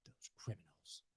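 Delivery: whispered mock aside; sarcastic whisper. A sarcastic whisper to viewers about crypto firms. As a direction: breathe it like a sly secret.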